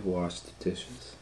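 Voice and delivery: plain reading voice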